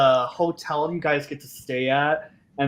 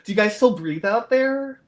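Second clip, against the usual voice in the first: high, delighted hostess voice; chatty ghost concierge; 10 semitones higher, 4 dB louder than usual. Acted. Ghost concierge welcoming visitors, high and excited. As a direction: gush at the visitors in a raised pitch.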